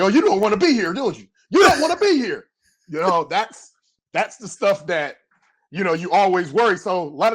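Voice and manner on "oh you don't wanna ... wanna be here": high, whiny taunt; mocking coach. Coaches mocking a late rookie, high whiny taunt. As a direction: tease the rookie who's in the wrong spot. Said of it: "they look at you like".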